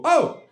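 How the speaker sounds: sudden loud, high scream of shock; jolted high "Oh!"; startled yelp. Retold or imitated. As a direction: yelp as if someone horrifying turned the corner. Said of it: "people go".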